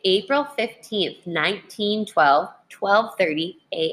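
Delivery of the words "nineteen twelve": ordinary delivery, nothing marked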